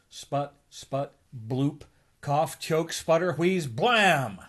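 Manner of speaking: spoken engine sputters building to a loud blam; car sputtering noises. Acted. Narrator mimicking the old car breaking down, sputtering. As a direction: act out a dying jalopy.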